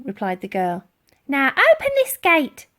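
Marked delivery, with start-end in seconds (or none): 1.3-2.6 much higher and louder lady's command; a bossy old lady giving an order; high imperious old lady command. A narrator's reading. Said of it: "ordered Dotty".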